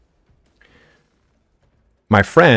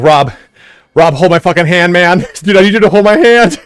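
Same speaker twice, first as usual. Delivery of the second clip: much higher and louder, desperate pleading; panicked plea; 8 semitones higher, 8 dB louder than usual. Retold or imitated. Tripping guy begging his friend Rob to hold his hand, panicked. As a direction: beg for help in a panic, laughing at the end.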